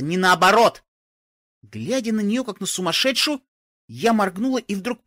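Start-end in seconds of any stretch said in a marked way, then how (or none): 0.0-0.8 irritated. A narrator's reading. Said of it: "раздражение в голосе Аликорна".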